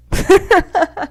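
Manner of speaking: shrill burst of laughter; high laugh. Real, not acted. Laugh after wishing misfortune.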